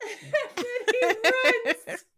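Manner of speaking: high, slow, breaking up with laughter; laughing. Real, not acted. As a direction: squeeze the words out through laughter. Teller cracking up at the scene.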